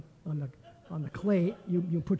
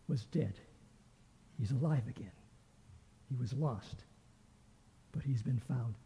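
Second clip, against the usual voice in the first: tender, hushed; 5 semitones lower, 7 dB quieter than usual. Acted. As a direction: let it fall quietly, full of love. Father gently answers elder son.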